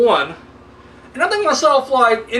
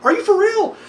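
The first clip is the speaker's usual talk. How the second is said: high, disbelieving what the fuck; mock outrage at a cheap cut; incredulous; 11 semitones higher than usual. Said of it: "And you're like".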